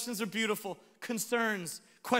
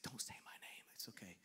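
very soft, mock-shy whisper; embarrassed whisper; 10 semitones lower, 20 dB quieter than usual. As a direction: whisper as if avoiding notice. Voices embarrassed Brandon hiding.

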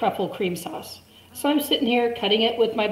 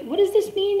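much higher, puzzled mock question; confused self-quote; 13 semitones higher than usual. Teller imitating her confused self asking classmates, higher. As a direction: ask it like a baffled tourist. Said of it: "I was like".

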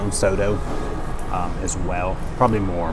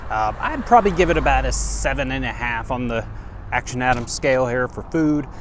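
higher, upbeat host wrap-up; vlog outro; 7 semitones higher than usual. Acted. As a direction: sell it to the viewers.

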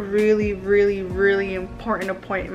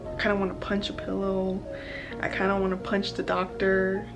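quiet and anxious; 9 dB quieter than usual. Real, not acted. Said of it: "But I'm so anxious".

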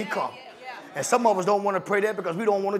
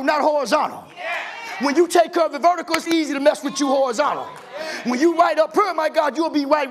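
preaching at full force; 4 semitones higher than usual. Acted. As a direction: whip the congregation up.

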